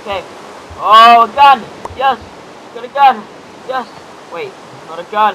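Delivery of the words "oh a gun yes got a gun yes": loud, excited gamer cheer; real delighted outburst, much louder; thrilled shout. Real, not acted. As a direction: celebrate the find at full volume. Finds a gun in game.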